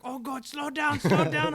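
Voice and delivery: high, loud, frantic begging; a scared passenger pleading for the driver to slow down; panicked passenger. Retold or imitated. Jemmy freaking out in the car.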